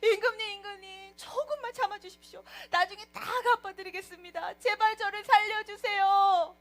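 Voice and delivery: hammed-up wailing plea, high and loud; tearful begging. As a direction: beg for mercy in a mock sob, building to a wail. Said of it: "막 엉엉 울면서 막 엎드려가지고 애원을 하기 시작했어요".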